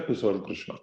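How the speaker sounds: hushed devout answer. Acted. A devotee replying to the teacher, hushed and sure. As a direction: say it like a simple truth, near a hush.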